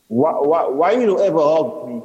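nervous rush, tripping on the first word; racing through words. A narrator's reading. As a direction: blurt the question out. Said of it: "racing through the words".

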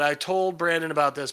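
ordinary talk, nothing marked

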